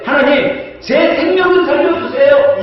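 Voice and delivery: king crying out to God for his life; desperate plea. Pretend. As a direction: beg God to spare your life. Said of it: "히스기야 왕은 뭐라고 기도하냐면".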